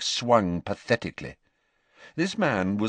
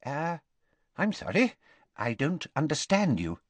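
hesitant, higher, halting apology; nervously; 6 semitones higher, 4 dB quieter than usual. A narrator's reading. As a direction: stammer it out, unsure and uneasy. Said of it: "said Ogden nervously".

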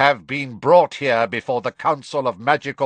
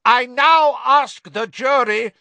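high, loud, slow courtroom shout; determined shout; 14 semitones higher, 8 dB louder than usual. A narrator's reading. Crouch shouts over his son to the jury, determined shout. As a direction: shout it slowly over the interruption. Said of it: "shouted Mister Crouch".

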